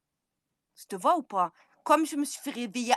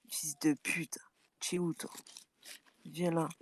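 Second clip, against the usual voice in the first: whispered, soft and menacing; whispered insult; 5 semitones lower, 8 dB quieter than usual. Real, not acted. Whispering insults at a rival in a live feud, menacing whisper. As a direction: breathe the insult, barely voiced.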